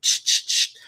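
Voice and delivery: imitated spray bursts, "tch, tch, tch"; short unvoiced hissing squirts; spray noise. Man sprays mist at the teller, imitated spray noise. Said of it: "he went".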